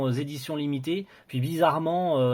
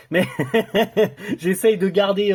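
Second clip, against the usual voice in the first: amused, laughter spilling into the words; laughing; 6 semitones higher, 7 dB louder than usual. Real, not acted. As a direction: talk through a laugh, higher and louder. Laughs after joking about Franglish.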